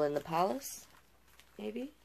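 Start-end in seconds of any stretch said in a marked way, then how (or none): none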